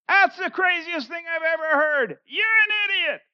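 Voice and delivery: high, loud, scornful jeer; Festus scoffing. Retold or imitated. Festus mocking Paul's gospel, high and loud. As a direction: sneer at the preacher as a fool. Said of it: "Festus goes".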